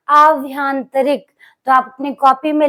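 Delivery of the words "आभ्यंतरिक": आभ्यंतरिक drawn out, long आ first; slow careful pronunciation. Shown as a demonstration. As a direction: dictate आभ्यंतरिक slowly. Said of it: "शब्द क्या होगा". (आभ्यंतरिक धीमे, साफ़ उच्चारण में)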